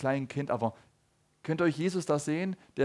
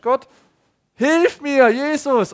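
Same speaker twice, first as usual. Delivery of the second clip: louder and higher, crying out to God; desperate cry for help; 10 semitones higher, 12 dB louder, much slower than usual. Retold or imitated. Preacher acts a believer crying to God, loud and pleading. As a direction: call out like someone at the end of their rope. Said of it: "so wie du bist und sagst".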